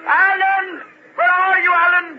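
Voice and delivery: loud, high desperate calls; calling out. Acted. David calling for Alan on the deserted shore, loud high calls. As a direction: shout across the empty shore for him.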